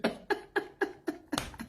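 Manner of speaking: wheezing laugh with no voice; breathless silent laugh. Real, not acted. Breathless laugh at the memory of the leader's call. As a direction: laugh so hard no sound comes out. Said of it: "when I laugh hard like this".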